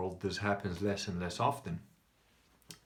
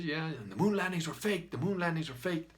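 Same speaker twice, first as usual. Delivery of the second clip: higher-pitched, insistent retold rant; a hint of the beer-hall ranter; lightly voiced shouty man; 6 semitones higher than usual. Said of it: "everything that the shouty man is saying".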